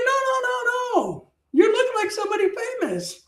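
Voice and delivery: high put-on voice for an insistent nurse; mock-squeal of a nurse insisting. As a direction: imitate her high, protesting voice.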